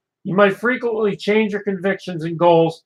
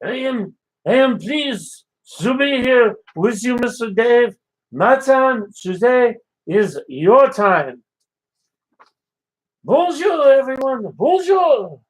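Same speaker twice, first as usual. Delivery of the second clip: put-on French chef accent; 4 semitones higher than usual. French chef greeting Dave on the morning show, in a French accent. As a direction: play the cheerful French chef, accent laid on thick.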